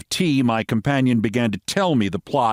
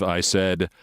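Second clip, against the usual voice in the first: plain reading voice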